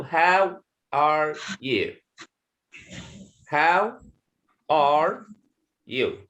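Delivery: slow, separated model sentence; each word on its own, with gaps. Shown as a demonstration. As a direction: spell it out one word at a time.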